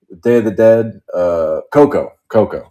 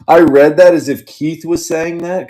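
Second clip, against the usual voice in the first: laughing; 8 semitones higher, 4 dB louder than usual. Real, not acted. Laughing while telling the story.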